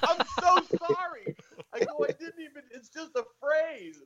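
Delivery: very high, squeaky retold apology; comic high-pitched pleading. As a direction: imitate yourself backpedalling, voice way up. Retold panicked apology to the digger.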